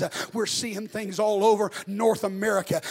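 rising to a booming shout; preaching at full force. Acted. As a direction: preach it out loud, building hard. Preacher builds again.

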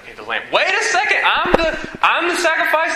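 hammed-up panicked boy Isaac; shocked realization. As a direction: jump up in pitch as it dawns. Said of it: "he's like".

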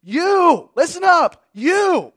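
high, loud commanding You; preacher barking you, listen up to the flock; emphatic call. Shown as a demonstration. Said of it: "you" is emphatic".

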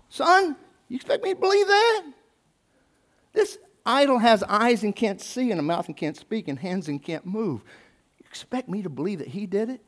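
high, incredulous father voice. Retold or imitated. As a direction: scold like a disbelieving dad. Terah voiced in story.

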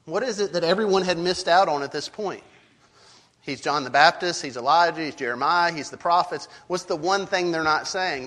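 ordinary talk

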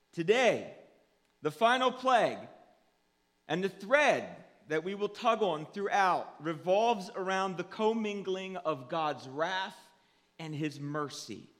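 loud, punched sermon announcement; emphatic preaching. Real, not acted. Pastor announcing the final plague, emphatic preaching. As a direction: project the theme to the whole church.

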